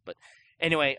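usual speaking voice

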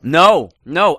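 loud emphatic no. Real, not acted. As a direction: burst out correcting. Correcting friend's wrong assumption.

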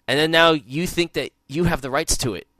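normal talking voice

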